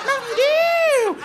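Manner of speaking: very high, drawn-out falsetto cry; slow, screechy mock-rapper shout; hyped-up holler. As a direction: whoop it like a wired kid. Brother like rapper swigging drink.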